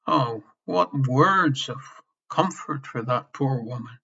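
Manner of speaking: ordinary talk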